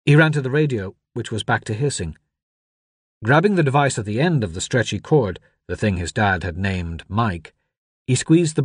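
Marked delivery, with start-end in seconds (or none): none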